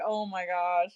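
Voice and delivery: a soft, breathy mock gasp of horror; quietly aghast, as if picturing the scene; hushed mock dismay. Picturing old neighbours opening the box, hushed mock dismay.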